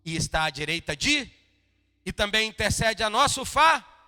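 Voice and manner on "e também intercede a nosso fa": loud, high rising proclamation; preacher's fervent shout; preaching shout. Real, not acted. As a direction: cry it out to the church.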